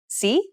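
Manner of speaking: Si rising like a question. Shown as a demonstration. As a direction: ask Si from a comfortable range. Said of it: "kind of sounds like you're asking a question".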